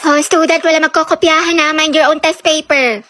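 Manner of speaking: ordinary talk, nothing marked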